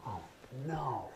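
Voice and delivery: soft whispered oh no; dismayed whisper. Retold or imitated. Bleeding student whispering to himself in dismay. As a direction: breathe it out in quiet dismay. Dismay after cut thumb.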